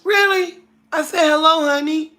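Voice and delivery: exasperated. Real, not acted. Scolding viewers who keep saying hi, high and exasperated. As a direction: throw up your hands at the viewers.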